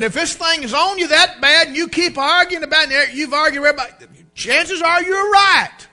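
high, loud pointed advice; preacher confronting the congregant; blunt counsel. Retold or imitated. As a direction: drive the point home.

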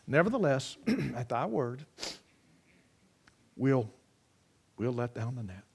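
grudging, quiet surrender; reluctant giving in. Retold or imitated. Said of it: "long dramatic pause".

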